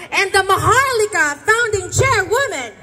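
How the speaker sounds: crowd-pumping event emcee introduction; announcer hype. Acted.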